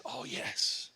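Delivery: soft, whispered concession; hushed realization. Acted. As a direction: let it dawn on you under your breath. Man quietly concedes to Jesus.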